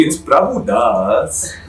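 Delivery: drawn-out mock Indian accent, laughing. Friend correcting the name for laughs, Indian accent. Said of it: "Are we allowed to do Indian accents?".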